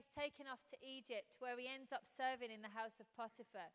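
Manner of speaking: ordinary delivery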